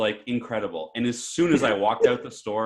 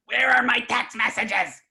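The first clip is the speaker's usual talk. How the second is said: high, slow, hammed-up demanding cry; imitating freaked-out customer; 14 semitones higher, 4 dB louder than usual. Retelling an old customer bursting back into the store, freaking out. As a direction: mimic an old man in a frantic panic. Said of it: "The guy's freaking out".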